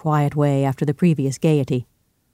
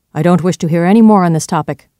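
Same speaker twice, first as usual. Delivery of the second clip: louder, firm refusal; cold, emphatic dismissal; 3 semitones higher, 8 dB louder than usual. A narrator's reading. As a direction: shut the subject down coldly. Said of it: "he said, firmly and coldly".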